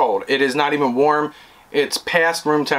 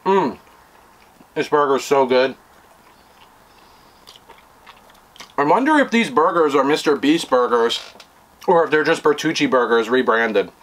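chewing while talking; talking between bites; mouth full. Real, not acted. Seen 1: Holds burger, mouth visibly chewing after earlier bites; burger down by 14.2.

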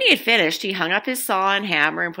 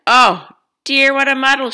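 loud, higher dismayed exclamation; exclaiming; 8 semitones higher, 9 dB louder than usual. A narrator's reading. Grandma finding the messy shed, loud dismayed exclaim. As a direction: cry out at the mess in dismay. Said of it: "she exclaimed".

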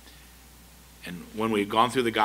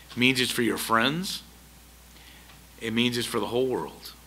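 usual speaking voice

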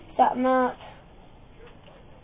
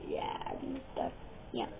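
ordinary talk

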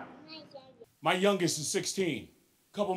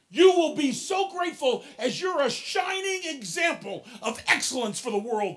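loud, rising sermon exhortation, higher and louder; fervent preaching; 5 semitones higher, 5 dB louder than usual. Real, not acted. Speaker urging young folks to thank their parents, loud fervent preaching. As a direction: preach it full force to the crowd.

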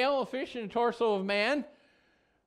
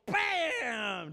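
shouted bam, a little higher; heavy statue crashing face down; idol hits the ground; 3 semitones higher than usual. Acted. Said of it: "And Dagon goes".

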